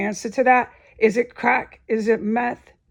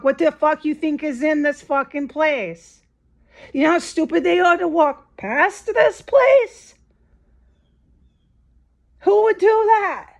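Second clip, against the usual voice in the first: exasperated rant; 6 semitones higher than usual. Real, not acted. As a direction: rant at how stupid people are. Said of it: "I'm getting pissed off".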